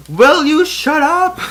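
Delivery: high, loud, slow exasperated yell; angry high yell. Pretend. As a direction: snap at him, fed up. Mace snaps at Yoda.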